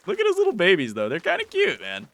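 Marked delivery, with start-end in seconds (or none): 0.0-0.9 cute voice. Real, not acted. Cooing over baby creatures. 1.1-1.8 cute voice. Real, not acted. Repeats the line, first take.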